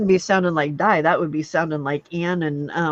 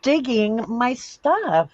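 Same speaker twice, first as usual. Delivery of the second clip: scolding; 6 semitones higher, 4 dB louder, much slower than usual. Real, not acted. Scolding the dog.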